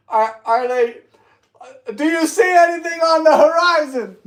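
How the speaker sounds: high, loud lookout call. Pretend. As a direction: shout toward the horizon. Playing lookout on patrol.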